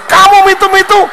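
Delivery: high, loud scolding through her teeth; she's fed up, hammer it at him; exasperated wife. Acted. Wife scolds her clumsy husband after stepping on his foot, high and loud.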